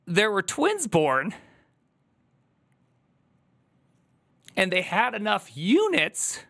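high, slow, tearful voice about to crack; voice breaking. Real, not acted. Streamer telling chat their charity money saved twins, voice breaking. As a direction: push the words out through tears. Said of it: "so I wouldn't cry a bunch".

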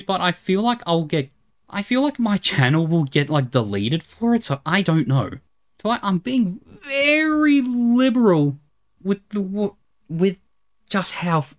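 normal talking voice

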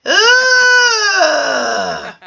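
long, high groan of frustration; exasperated groan. Acted. Host fed up with bachelor one, drawn-out groan.

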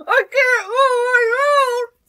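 high, loud cry of distress; panicked wailing. Acted. Girl losing her life, panicked. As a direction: wail it out in panic.

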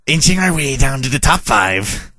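usual speaking voice

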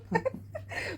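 breath of high giggling; real teasing chuckle; soft laugh. Real, not acted. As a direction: let out a small quiet giggle. Laughs after teasing her husband.

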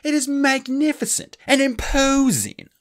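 high, loud, slow outraged cry; indignant cry. Acted. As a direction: cry out, stung. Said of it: "his voice rising".

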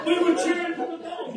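quick, laughing retelling; laughing echo of the punchline; laughing repeat. Real, not acted. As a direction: toss it off through a laugh. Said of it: "This is God's truth".

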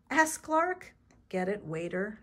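ordinary delivery

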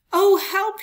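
high, loud yelp of fear; scared cry. Acted. Fox yelping in fear at the Gruffalo. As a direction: yelp it and flee.

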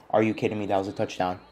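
ordinary talk

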